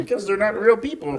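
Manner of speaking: comic puppet-frog voice; froggy character voice, played for laughs; Kermit the Frog. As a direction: say it as a cartoon frog. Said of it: "using the Kermit voice".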